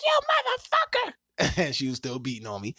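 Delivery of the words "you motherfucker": high, shrill-sounding falsetto imitation of his girlfriend; mock girlfriend. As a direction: squeak it out like the angry girlfriend he's quoting. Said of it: "she was like".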